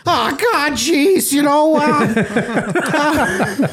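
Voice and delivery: Jordan Peterson as Morty. Retold or imitated. Mocking Peterson to friends, high stammering Morty voice. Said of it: "talks like Morty".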